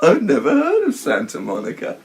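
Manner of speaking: high, loud, goofy dim-witted voice; Eric impression. Imitating Eric answering Jamie about Santa Monica, mock clueless. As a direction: play the clueless dope, hammed up and laughing. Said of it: "And Eric's like".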